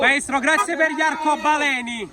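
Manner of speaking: high, slow, loud thanks; cheering. Real, not acted. Fans thanking the coach as he drives by. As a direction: gush at him from the roadside.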